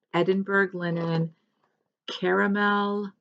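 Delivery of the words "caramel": normal talking voice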